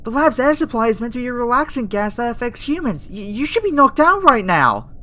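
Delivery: loud, high-pitched panic; alarmed outburst. Acted. Scientist finding the human awake instead of asleep, alarmed. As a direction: blurt it out loudly in startled disbelief.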